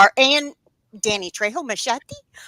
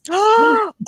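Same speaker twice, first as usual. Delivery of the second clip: a high, loud, delighted gasp; sudden high intake of breath, thrilled; sharp gasp; 12 semitones higher, 4 dB louder than usual. Real, not acted. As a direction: gasp in happy surprise. Reacting to the Gilmore Girls reveal.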